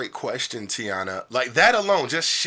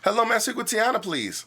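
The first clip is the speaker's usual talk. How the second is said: friendly phone call; 7 semitones higher than usual. Shown as a demonstration. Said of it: "I'm gonna call you".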